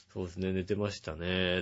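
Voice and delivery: low, soft, sleepy reply; just-woken voice. Retold or imitated. Woken friend replying on the phone, imitated just-woken voice. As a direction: mumble it like someone just roused. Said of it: "寝起きの声で出られましてね".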